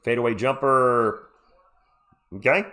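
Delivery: ordinary delivery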